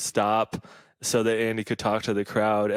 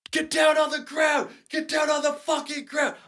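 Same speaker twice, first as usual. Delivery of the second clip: much higher, strained cop shout; imitating a yelling cop; 3 dB quieter than usual. Cop ordering him to the ground at four AM: high mock shout. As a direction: bark the order like a cop mid-arrest. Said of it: "who just yelled that at you".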